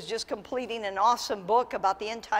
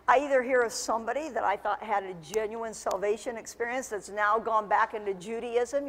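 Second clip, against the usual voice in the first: usual speaking voice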